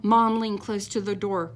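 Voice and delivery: usual speaking voice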